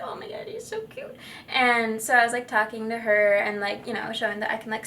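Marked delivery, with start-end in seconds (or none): 0.0-1.2 high, soft cooing over a cute puppy; cooing at a dog. Retold or imitated. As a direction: coo like talking to a little dog.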